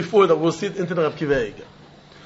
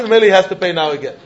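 normal talking voice

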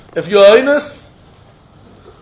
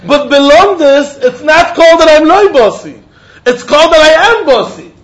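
emphatic; 6 semitones higher, 5 dB louder than usual. Shown as a demonstration. Teacher stressing the key distinction to students, emphatic. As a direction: drive the point home. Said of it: "teacher stressing the point".

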